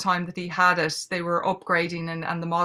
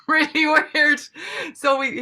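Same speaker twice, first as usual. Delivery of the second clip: high, loud burst of amusement; laughing; 10 semitones higher, 7 dB louder than usual. Real, not acted. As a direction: say it through a laugh, pitched up. Amused recalling the odd computer voice.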